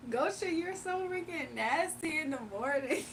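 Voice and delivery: laughing at Goshen's gross joke, can barely finish. Real, not acted. To the chatter Goshen, grossed out, laughing hard.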